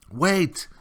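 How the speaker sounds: sharp high cry of wait; urgent call to stop her; boy crying out to the old turtle. A narrator's reading. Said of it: "cried Atreyu".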